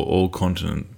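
usual speaking voice

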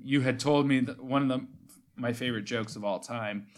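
usual speaking voice

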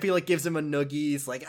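ordinary talk

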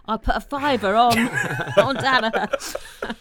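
a laughing, hammed-up policeman; laughing through the quote. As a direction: quote the policeman while cracking up. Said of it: "And then he said".

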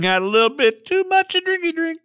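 sing-song high mock drunk. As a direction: tease him like a tipsy guy. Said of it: "drinky drink, joking about a DUI".

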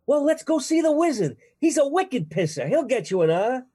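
high, loud, cheerleading Boston voice; Boston accent. Acted. Oz character offers to take Dorothy to the wizard, loud Boston accent. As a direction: belt it out like a broad Bostonian. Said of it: "have a Massachusetts accent".

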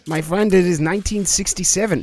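put-on comic German-accented protest. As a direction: correct them as a German sailor. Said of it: "I'm from West Germany".

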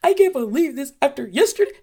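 high, loud nagging-parent voice; mock nagging. Mother to kids, mocking a nagging parent, in a high loud voice. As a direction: ham up the exasperated parent. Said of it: "mocking a nagging parent".

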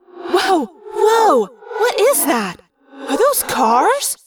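high, loud, astonished cries; amazed demand. Acted. Scene: Cassie seeing glowing cars through fly eyes, astonished. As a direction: gasp it out, stunned at the sight. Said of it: "Cassie demanded".

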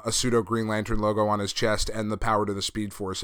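ordinary talk, nothing marked

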